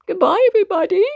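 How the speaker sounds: hammed-up tearful goodbye; mock sad farewell. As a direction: say goodbye as if all is lost. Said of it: "two big tears rolled down".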